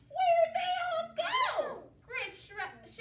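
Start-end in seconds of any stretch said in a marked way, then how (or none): 0.1-1.8 very high, loud and slow; shrieking witch. Acted. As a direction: screech it in frustration. Witch finds no pigs.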